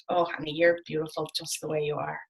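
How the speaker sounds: a soft, placating husband, lightly voiced; husband's voice. Husband voiced in retold scene.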